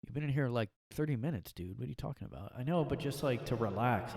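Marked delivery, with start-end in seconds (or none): none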